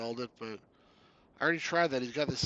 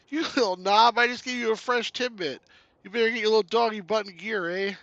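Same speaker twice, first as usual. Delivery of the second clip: high, loud mock scolding in a Canadian voice; scolding Canuck hero; 9 semitones higher, 10 dB louder than usual. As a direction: scold the dog like a cartoon Canuck.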